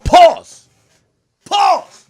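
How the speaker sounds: high, loud yelp of pause; squealed, shouted meme interjection. Real, not acted.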